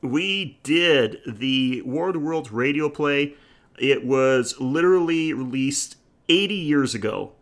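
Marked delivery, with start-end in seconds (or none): none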